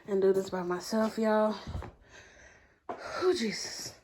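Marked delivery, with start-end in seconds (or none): none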